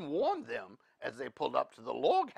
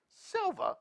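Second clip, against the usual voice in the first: usual speaking voice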